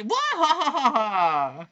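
high, slow evil villain laugh; maniacal cackle. Retold or imitated. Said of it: "cackling maniacally".

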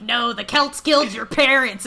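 loud, emphatic mock objection; scolding inner voice. Inner voice overruling Ellerin's doubts, mock scolding. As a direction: cut in loudly with the damning reminder. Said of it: "little, like, racist voice inside of her head's like".

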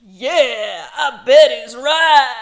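loud exaggerated twang; twangy voice. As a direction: belt it in a twangy drawl. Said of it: "No, absolutely not".

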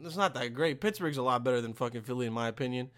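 ordinary delivery

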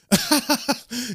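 hard devilish laugh. Acted. Lucifer laughing at the duped demons, high and hard. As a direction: let out a big gloating laugh. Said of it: "begins to laugh really hard".

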